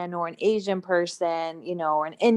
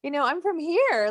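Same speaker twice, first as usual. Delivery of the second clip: pitched up, fast and loud on the middle question; incredulous retort; 8 semitones higher, 3 dB louder than usual. Retold or imitated. A student answering classmates who ask where she's from, incredulous and quick. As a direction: push back in disbelief. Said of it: "And I'm like".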